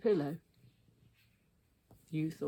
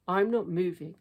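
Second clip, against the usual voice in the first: defiant Henry; 2 semitones higher, 8 dB louder than usual. A narrator's reading.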